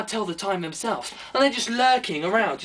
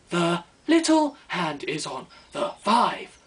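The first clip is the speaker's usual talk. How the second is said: slow, lower, instructional narrator; mild teaching-tape voice, slow and lower; tutorial voice; 5 semitones lower, slower than usual. Acted. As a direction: talk like a patronising lesson recording. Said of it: "a tutorial's in my head".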